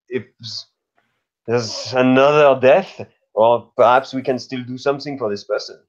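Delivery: usual speaking voice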